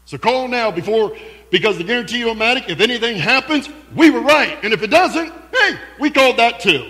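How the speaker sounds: high, loud, fast hard-sell close; infomercial announcer. Pretend. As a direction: shout the call to action like an ad. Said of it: "It sounds like an infomercial".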